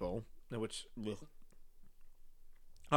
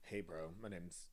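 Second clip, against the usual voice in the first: very low, soft, slow bro; straight guy voice; 9 semitones lower, 16 dB quieter than usual. Acted. Trying out as a dating show contestant, low bro voice. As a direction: drop deep and quiet like a bro. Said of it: "make my voice lower".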